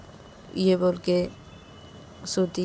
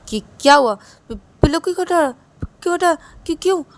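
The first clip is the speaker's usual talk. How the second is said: startled question; 9 semitones higher, 8 dB louder than usual. A narrator's reading. Confused stammering at thrown pillow.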